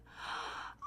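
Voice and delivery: quick, voiceless intake of breath; hopeful gasp. Retold or imitated. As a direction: gasp as if rescue has just arrived. Retold reaction to offered help.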